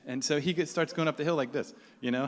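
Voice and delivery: ordinary talk, nothing marked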